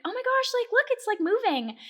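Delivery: high-pitched amazed marvelling; retold wonder. Acts wonder at the screen.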